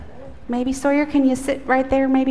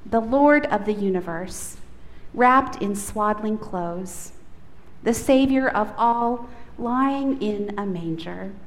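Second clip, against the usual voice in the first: slow, hushed prayer voice; 4 dB quieter than usual. Acted. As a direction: pray it reverently, softening at the ends. Opening prayer in worship.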